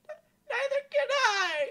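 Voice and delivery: high, slow tearful cry; princess crying out. Acted. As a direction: let the tears break through. Amelia crying out.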